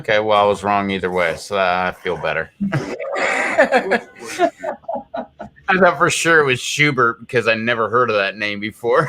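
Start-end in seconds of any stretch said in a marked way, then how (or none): 2.5-5.6 breathy high laugh. Real, not acted. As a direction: burst out laughing. Laughs at wrong answer.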